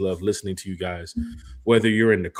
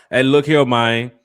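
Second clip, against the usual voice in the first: loud, higher Memphis greeting, looping hey look here; Memphis talk; 3 semitones higher, 7 dB louder, slower than usual. Retold or imitated. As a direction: imitate a Memphis guy calling out, loud and high. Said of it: "Is that, is that how they talk?".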